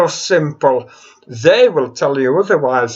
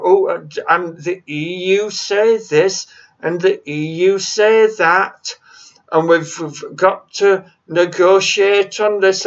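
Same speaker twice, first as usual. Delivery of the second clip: usual speaking voice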